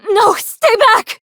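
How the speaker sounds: high, loud, slow cry to keep back; alarmed shout. Acted. Cornered fighter shouting at an attacker, alarmed. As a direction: warn them off, voice rising in alarm. Combat bark, backing off.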